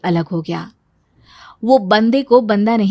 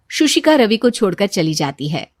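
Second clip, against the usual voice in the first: ordinary talk, nothing marked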